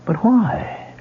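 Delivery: high, rising cries of disbelief; shocked protest. Acted. Fired actor protesting to the producer's wife, shocked and pleading. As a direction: plead in stunned disbelief, voice climbing.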